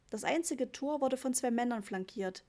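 usual speaking voice

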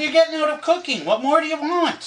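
high, indignant teasing protest. Real, not acted. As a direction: play up the mock outrage at her. Teasing argument with wife.

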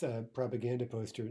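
ordinary talk

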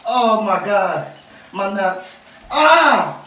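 slow pained groans; loud cries in the cold; crying out. Real, not acted. Enduring ice bath.

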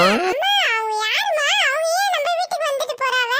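ordinary talk

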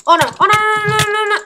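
high, rapid string of no's; panic, stretching into one high held note; high-pitched dread in mid-game. Real, not acted. Panicking as the game goes wrong.

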